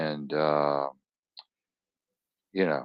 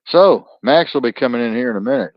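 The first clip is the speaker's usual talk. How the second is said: ordinary delivery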